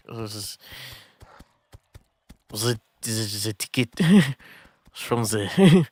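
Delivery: halting, fumbled French accent, breaking into a laugh; attempted French accent. Shown as a demonstration. Streamer fumbling to redo an accent for viewers, attempted French accent. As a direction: try out a shaky-confidence French accent. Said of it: "I've never tried a French accent".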